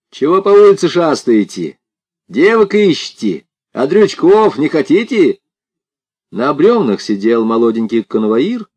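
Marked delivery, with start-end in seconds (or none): 0.1-5.4 high, loud heckling; taunting. A narrator's reading. Village bully challenges passing strangers, taunting loudly and high. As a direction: goad them with pushy high-pitched questions.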